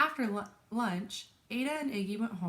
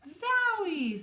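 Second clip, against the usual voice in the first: high, loud, stretched storybook cry; amazed exclamation; 10 semitones higher, 7 dB louder than usual. A narrator's reading. Ada reacts to the ketchup explosion, excited cry.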